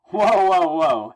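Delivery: loud, high "whoa" cutting in; alarmed interjection. Acted. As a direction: burst in to halt a standoff. Spidey stopping the standoff.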